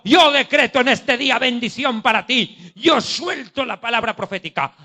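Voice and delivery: loud, slow "yo profetizo", fading to a mutter on the yo's; mocking prosperity preacher. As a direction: ham up a self-important prophet then let it dwindle. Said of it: "qué dicen en el púlpito". (burla de predicador de prosperidad, de fuerte a apagado)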